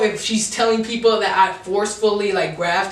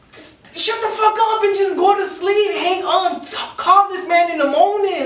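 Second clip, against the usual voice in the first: retold shout; 8 semitones higher than usual. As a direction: bark it out like you're at your limit. Yelling at her again.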